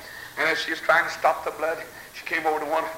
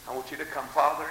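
ordinary talk

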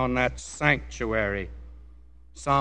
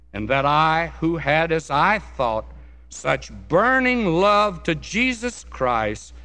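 fervent declamation, swelling then settling; dramatic testimony reading; 4 dB louder than usual. Acted. Preacher reading another Bunyan excerpt, dramatic testimony reading. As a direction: read it out with weight on the key words.

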